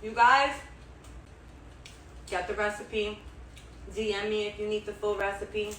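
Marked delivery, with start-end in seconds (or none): 0.0-0.5 loud emphatic you guys. Shown as a demonstration. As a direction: call out you guys loudly. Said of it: "repeated call to viewers".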